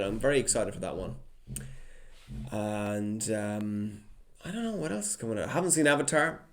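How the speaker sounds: ordinary talk, nothing marked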